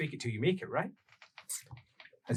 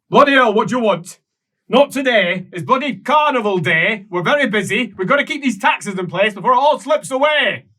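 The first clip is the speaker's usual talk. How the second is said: much higher and louder, harried yelling; shouting through a door; 10 semitones higher, 11 dB louder than usual. Acted. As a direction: bellow from behind the door.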